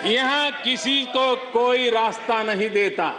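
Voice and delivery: measured ghazal recital with long pauses; poem recitation. Acted. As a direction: recite the couplets line by line to an audience.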